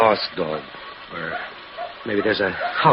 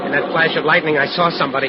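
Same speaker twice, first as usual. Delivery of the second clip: higher and louder, startled; 7 semitones higher, 6 dB louder than usual. Acted. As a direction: blurt it out in shock. Husband sees figure in lightning.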